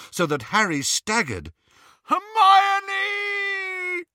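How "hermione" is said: long, high, crying yell; bellow. A narrator's reading. Ron struggling in his ropes for Hermione, drawn-out bellow. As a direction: wail the name out loudly. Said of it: "Ron bellowed".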